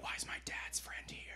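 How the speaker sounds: whispered, barely audible; girl whispering to her friend; whispered aside. Acted. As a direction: breathe it out like a scared girl. Girl's whispered reaction.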